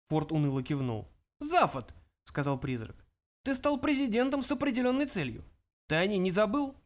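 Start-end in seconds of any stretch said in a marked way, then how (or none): 1.4-1.9 put-on high elderly voice; old ancestor's high address; solemn old ghost. 3.4-6.8 put-on high elderly voice; old ancestor's high address; solemn old ghost. Ghost questions Zaphod.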